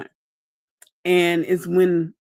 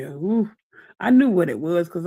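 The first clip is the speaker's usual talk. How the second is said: normal talking voice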